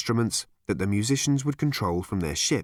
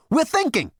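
much higher, louder snap; snapped hush; 5 dB louder than usual. Acted. Ford and Zaphod hushing Arthur, high loud snap. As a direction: shut Arthur up sharply.

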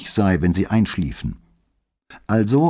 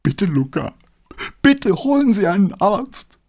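high, breaking, sobbing plea; sobbing plea to the neighbour for a doctor; 13 semitones higher, 3 dB louder than usual. Acted. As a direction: beg through sobs as the voice fails. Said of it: "Plötzlich versagte ihr die Stimme und sie schluchzte".

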